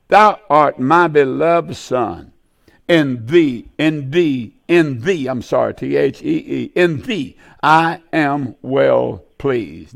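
slow, weighty reading of God's words; slow solemn scripture reading. Acted. Preacher reading God's words to his Son slowly and solemnly. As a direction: let each word of the verse land slowly.

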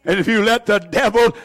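ordinary delivery

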